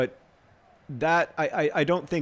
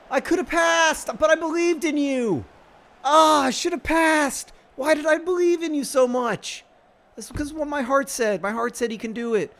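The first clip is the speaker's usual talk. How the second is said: much higher, louder theatrical wail; anguished lament; 12 semitones higher, 6 dB louder than usual. Real, not acted. Manager lamenting his striker's miss, theatrical anguish. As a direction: wail the regret.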